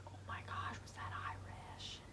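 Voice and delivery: hushed, embarrassed whisper; a mortified whisper to her aunt. Retold or imitated. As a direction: whisper it so no one nearby hears. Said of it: "whisper to my aunt".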